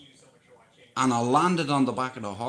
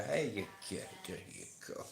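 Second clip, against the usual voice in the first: much softer, near-whisper soothing; 3 semitones lower, 6 dB quieter than usual. Shown as a demonstration. Man soothing a mare while she eats, soft hush. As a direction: hush her calm. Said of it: "I go up to you and I say".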